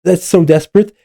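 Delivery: normal talking voice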